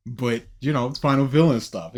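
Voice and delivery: ordinary talk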